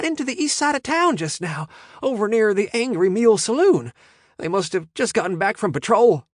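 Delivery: high, loud breathless panic; panicked. A narrator's reading. Lookout warning the gang of police, high panicked voice. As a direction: rush the news out in a panic. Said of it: "In a panicked voice".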